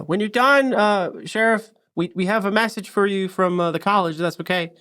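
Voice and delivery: high, stammering, nervous greeting; flustered receptionist. Acted. Young receptionist startled by the sheriff barging in, high flustered stammer. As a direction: trip over the words like a startled young clerk. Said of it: "kinda leans over, like, a kinda divider and goes".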